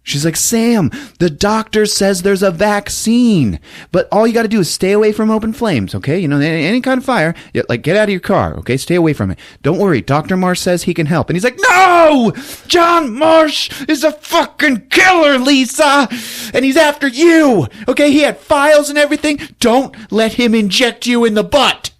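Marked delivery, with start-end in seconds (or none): 0.3-11.3 high, quick fretful woman; pleading girlfriend voice, rushed; Lisa on the phone. Retold or imitated. Lisa urging Sam over the payphone, fretful and quick. 11.7-21.9 high, loud mock shouting; screaming down the phone in hammed-up rage; furious yell. Sam shouting warnings at Lisa on the phone, hammed-up.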